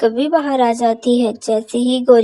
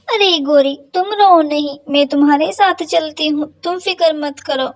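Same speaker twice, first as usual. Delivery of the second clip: higher, reassuring friend; comforting sparrow; 4 semitones higher than usual. Acted. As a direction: soothe a crying friend.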